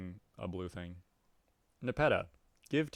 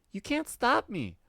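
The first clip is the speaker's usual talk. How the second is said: put-on much higher, childish defiant cat girl; 14 semitones higher, 7 dB louder than usual. Cat girl refusing the troll's order, high and childish. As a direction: squeak back like a defiant kitten.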